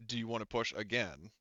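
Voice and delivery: ordinary talk, nothing marked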